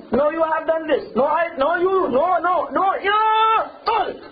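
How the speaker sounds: high-pitched, escalating marital squabble; heated mock argument. Husband arguing with his wife until he divorces her, hammed-up anger.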